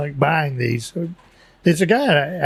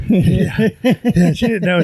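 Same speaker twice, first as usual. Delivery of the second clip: laughing; 3 semitones higher, much slower than usual. Real, not acted. Laughing recall of daughter.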